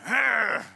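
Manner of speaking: hammed-up angry growl; playful snarl of rage; mock growl. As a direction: growl like a cartoon persecutor. Acting out Paul hating Christians.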